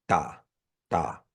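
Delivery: ta with no burst; relaxed ta. Shown as a demonstration. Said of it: "not popping it in any way".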